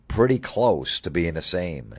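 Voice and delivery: ordinary talk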